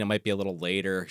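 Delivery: usual speaking voice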